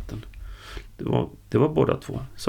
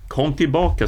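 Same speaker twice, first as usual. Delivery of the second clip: loud, higher-pitched call; shouting; 5 semitones higher, 6 dB louder than usual. A narrator's reading. Karl shouts from the pit after the vanished man, shouting. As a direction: shout up after someone walking off. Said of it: "skrek Karl igen".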